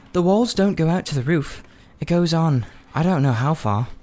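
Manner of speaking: put-on a young girl setting him straight; girl Polly explaining. A narrator's reading. Polly tells Diggory the tunnel goes on, lightly voiced girl.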